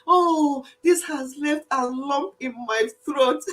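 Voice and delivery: put-on English show of being moved; imitating English people. Host repeats what English people say when touched, mock-emotional imitation. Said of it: "That's how they say it".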